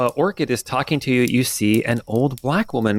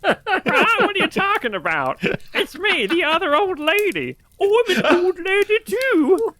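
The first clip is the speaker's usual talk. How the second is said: put-on very high squawking old woman; parrot-like old lady. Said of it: "you sounded like a parrot".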